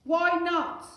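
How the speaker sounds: high, loud exasperated scream; furious mother's scream; Mum screaming. Acted. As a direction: scream it in frustration. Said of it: "screamed Mum".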